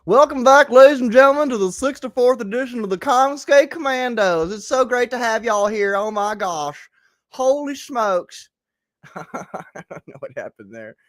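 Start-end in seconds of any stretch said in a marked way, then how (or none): 0.1-6.9 hammed-up Southern show host, high and loud; Southern accent. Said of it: "I said that like Trump ... suddenly you're a Southerner". 7.3-8.5 high, slow Southern exclamation; mock Southern amazement; Southern accent. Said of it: "suddenly you're a Southerner".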